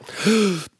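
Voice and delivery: loud, sharp intake of breath; big gasp. Acted. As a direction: gulp air as if to hold it. Big breath before keeping quiet.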